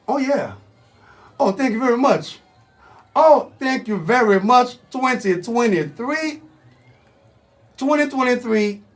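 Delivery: high, hyped-up host announcing; radio show opening. Acted. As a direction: welcome listeners back like a showman.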